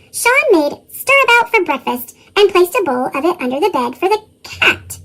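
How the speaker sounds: plain reading voice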